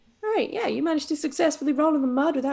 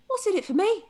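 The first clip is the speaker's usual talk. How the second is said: pig voice; 8 semitones higher than usual. Acted. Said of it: "he sort of goes".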